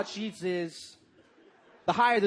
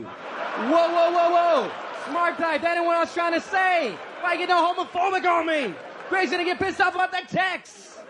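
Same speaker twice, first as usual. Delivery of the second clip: high and loud, scolding the phone with a laugh in it; mock outraged shout; 9 semitones higher, 6 dB louder than usual. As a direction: yell at your phone like it betrayed you. Yelling at his phone's autocorrect.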